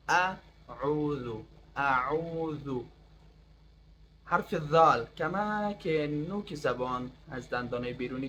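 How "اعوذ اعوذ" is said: اعوذ drawn out slowly, the ع squeezed from mid-throat; throat ayn demo. Shown as a demonstration. Teacher shows students the throaty ayn in اعوذ, slowly. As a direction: press the middle of the throat on اعوذ.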